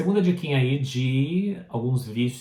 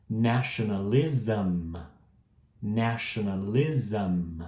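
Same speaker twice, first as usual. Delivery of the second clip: nationalism said slowly, ending zam; slow teacher demo; slow model reading; 2 semitones lower, much slower than usual. Shown as a demonstration. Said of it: "repete depois de mim".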